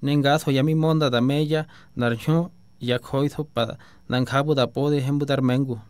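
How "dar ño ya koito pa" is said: normal talking voice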